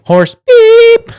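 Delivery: high, loud horse beep; loud mock bleep. Redoing his censored curse with a loud beep. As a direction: blast the bleep out.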